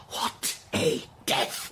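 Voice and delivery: voiceless whisper, very slow; hushed. Acted. Invalid dwelling on the dead wife's end, whispered slowly. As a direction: drag out the words in awe.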